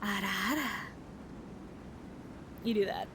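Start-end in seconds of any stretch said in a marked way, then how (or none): 0.0-0.7 coy anime tease, a touch lower; sultry "Ara Ara" said leaning in; teasing ara ara. Shown as a demonstration. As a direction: lean in and purr "Ara Ara" low and teasing. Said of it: "So you lean in and go".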